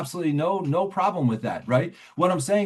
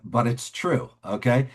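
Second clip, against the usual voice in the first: ordinary talk, nothing marked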